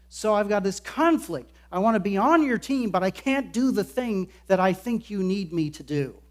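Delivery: loud, pleading and insistent, pushing every "know"; emphatic Peter. Acted. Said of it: "why Peter answers so emphatically".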